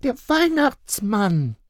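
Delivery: put-on high, slow child voice; reply like a small girl; answering child. A narrator's reading. Little girl answering her father, high and slow. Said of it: "erwiderte Luca".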